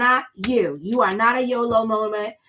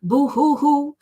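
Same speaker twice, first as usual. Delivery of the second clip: higher, louder hammed-up boo-hoo; mock crying; 4 semitones higher, 3 dB louder, much slower than usual. Tarot reader mocking heartbroken crabs with hammed-up boo-hoo. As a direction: pretend to blubber like a sulking child. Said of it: "It's gonna be like".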